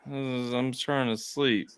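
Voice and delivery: slow, drowsy half-asleep reply; sleepy mumble. Retold or imitated. Husband imitating his wife too sleepy to answer. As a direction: drag it out like you're barely awake. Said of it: "And she goes".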